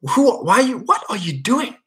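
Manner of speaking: high-pitched, incredulous dad; baffled dad. Acted. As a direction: stammer it out high, as if totally bewildered. Imagined dad baffled at pleading son.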